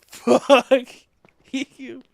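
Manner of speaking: loud high yell breaking into laughter; laughing shout. Retold or imitated. Yelling at Crystal who came back, laughing shout. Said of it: "I'm like".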